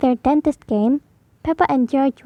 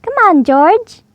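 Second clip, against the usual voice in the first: loud, higher calling out; shouted; 6 semitones higher, 6 dB louder than usual. Acted. Peppa shouting to her brother to hurry. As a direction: call him up the stairs. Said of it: "shouted Peppa".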